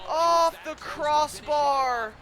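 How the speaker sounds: long-drawn agonised call; drawn-out lament. Real, not acted. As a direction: stretch each word out in disbelief. Lamenting the near miss.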